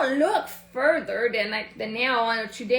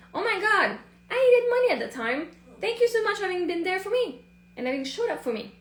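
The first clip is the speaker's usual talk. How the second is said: grateful gush; 8 semitones higher, faster than usual. Retold or imitated. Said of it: "tonight I said".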